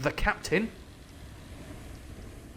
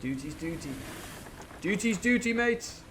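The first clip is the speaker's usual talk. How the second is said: Silver's catchphrase; 7 semitones higher than usual. Retold or imitated. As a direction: bark it like a jolly pirate, "doo-ty". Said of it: "his little catchphrase".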